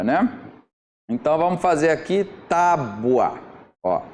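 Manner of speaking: ordinary delivery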